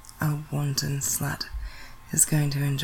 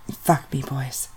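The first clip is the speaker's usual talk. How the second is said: usual speaking voice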